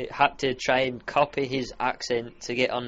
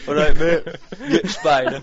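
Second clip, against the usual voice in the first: laughing blokey mock mum, louder; gruff joke mum, through laughter; butch mum voice; 9 dB louder than usual. Son playing his mum as a bloke, laughing. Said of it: "speaking with your voice".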